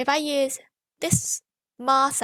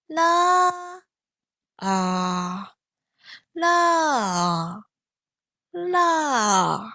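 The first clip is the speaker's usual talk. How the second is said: fourth tone demo; 4 dB louder, much slower than usual. Shown as a demonstration. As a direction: fall from top to bottom. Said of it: "join those sounds together".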